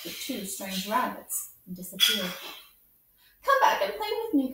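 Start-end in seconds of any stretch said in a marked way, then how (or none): 3.4-4.5 high, loud plea; a lonely rabbit calls his new friends back; calling after them. A narrator's reading. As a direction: call out after the leaving rabbits. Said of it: "called the little rabbit".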